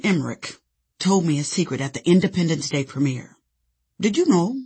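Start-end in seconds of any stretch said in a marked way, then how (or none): 4.0-4.6 put-on cute German accent, lightly done; slightly higher, light German-accented question. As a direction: tease it out with a hint of German. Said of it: "he asked in his cute German accent".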